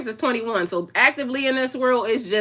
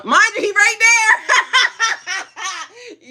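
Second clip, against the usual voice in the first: high, slow, laughing yell as dad shows up; delighted shriek of surprise; excited laughing shout; 13 semitones higher, 5 dB louder, much slower than usual. Real, not acted. Father appears on live.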